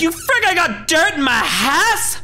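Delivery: high, slow shrieking grandma; angry grandma. Retold or imitated. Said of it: "She's like".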